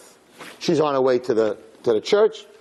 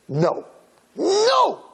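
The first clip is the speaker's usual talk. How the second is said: the second no leaps high in a desperate scream; a soul crying out to heaven in anguish. A narrator's reading. Soul screams up to God in anguished high cry. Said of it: "painful soul voice screaming from earth".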